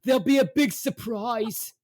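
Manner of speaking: high, slow, hammed-up moan; moaning mother. Mother rocking on the bench, moaning. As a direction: imitate a mother wailing in distress.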